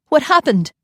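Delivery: high, loud and frantic; frantic question. Acted. Mother rushing to the nanny, frantic. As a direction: demand it in a panic.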